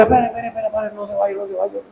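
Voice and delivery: soft, hushed pleading plea. Retold or imitated. Priest voicing the weeping man who begs him to stay, hushed and pleading. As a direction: beg quietly as if still in tears.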